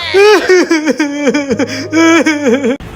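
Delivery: villain laugh. Acted. Villain laughs after refusing.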